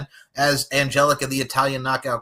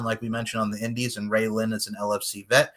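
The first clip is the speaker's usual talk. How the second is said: normal talking voice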